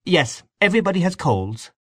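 high, prompt agreement; readily. A narrator's reading. Aunt Kate smoothing over the rudeness, ready agreement. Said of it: "said Aunt Kate readily".

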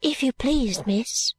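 put-on high, timid-sounding child servant; soft-voiced little girl. A narrator's reading. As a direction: voice a small girl politely addressing her mistress. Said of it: "said the little girl in a soft voice".

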